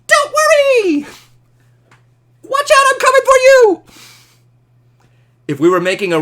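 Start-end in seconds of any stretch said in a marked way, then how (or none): 0.1-3.8 squeaky sped-up voice. Pretend. Superman flying in at light speed, very high and loud. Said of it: "it would come out as".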